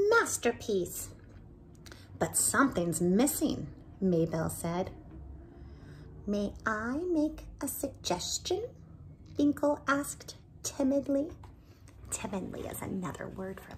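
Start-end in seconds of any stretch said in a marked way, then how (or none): 0.1-11.3 put-on lively read-aloud for children; animated storyteller voicing each character; story narration. A narrator's reading. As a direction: tell it like a bedtime storybook. Reading picture book aloud.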